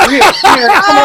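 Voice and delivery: high, loud protest over the price; outraged shout. Retold or imitated. Girl haggling shouts back at the buyer, outraged. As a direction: yell it like she's being robbed.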